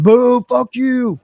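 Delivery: high, drawn-out crowd jeer; imitated booing. Crowd turns on the villain wrestler, mock heckling. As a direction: boo slowly like an angry crowd. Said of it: "They're booing me".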